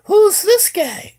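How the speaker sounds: very high, loud, drawn-out mimicry; mock suspicious woman. Girl asks about his new friend, mock suspicion in a high voice. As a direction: imitate a woman demanding an answer, high and slow.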